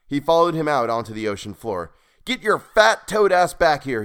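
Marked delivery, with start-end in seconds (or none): 2.2-4.0 high, loud, indignant yell; angry cry. A narrator's reading. Man chasing the creature who stranded him, angry cry. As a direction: bawl it after the fugitive. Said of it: "he cried".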